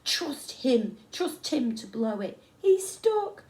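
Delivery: mock him from above; jeering shout. A narrator's reading. Kids jeering at fallen boy.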